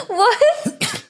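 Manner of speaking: stunned disbelief. Retold or imitated. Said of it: "And I was like".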